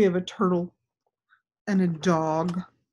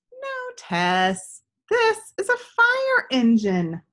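lightly voiced boy, higher and louder; a hint of a kind friend correcting her; patient boy answering; 13 semitones higher, 4 dB louder, slower than usual. Gus answers Tess in story.

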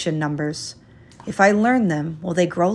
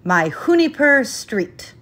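higher, slow proud call across the yard; happy yell; 7 semitones higher, 4 dB louder, slower than usual. A narrator's reading. Boy calling his new street's name to the chickens, in a slow happy yell. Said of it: "I yell to the chickens".

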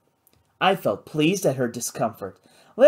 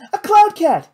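very high, loud excited cry; excited announcement; 8 dB louder than usual. A narrator's reading. Kate tells Miss Carr what she saw, high excited cry.

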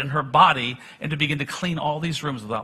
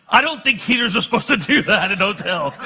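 laughing through the quote; 2 semitones higher, 4 dB louder than usual. Real, not acted. Preacher retelling hotel story to congregation, laughing. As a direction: chuckle out the words. Joking about noisy hotel heater.

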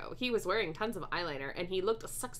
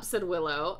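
usual speaking voice

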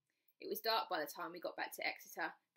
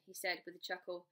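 usual speaking voice

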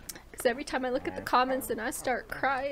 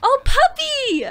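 higher, louder, slow coo at a puppy; gushing over a cute dog; delighted; 13 semitones higher, 10 dB louder, slower than usual. Real, not acted. Player spots the puppy in the game, delighted coo.

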